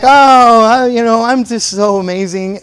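loud, high swoon easing down; mock self-adoration. Preacher voices a vain cat, gushing self-admiration. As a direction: open on a big gushing "Oh".

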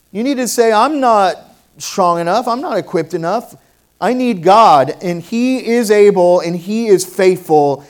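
loud, high preaching, building to a shout; full-force preaching. Acted. To the church, proclaiming God is faithful, loud preaching. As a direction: proclaim it to the whole room.

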